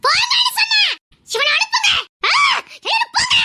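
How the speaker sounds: very high, furious shout; screaming. Acted. Girl throwing everyone out, screaming. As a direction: scream at them all to get out. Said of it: "கத்தாதம்மா".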